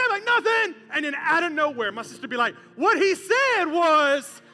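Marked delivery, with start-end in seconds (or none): 0.2-0.8 high, loud mock-innocent denial; defensive kid. Kid denying to mom. 2.8-4.4 loud, drawn-out tattletale voice; tattling sister. Retold or imitated.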